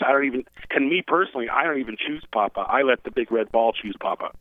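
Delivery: normal talking voice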